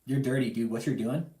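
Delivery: ordinary talk, nothing marked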